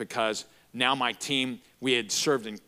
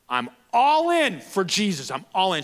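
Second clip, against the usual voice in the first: retold fervent cry; fervent declaration; 9 semitones higher, 7 dB louder than usual. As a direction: call it out like giving your life. Girl declares at altar, excited.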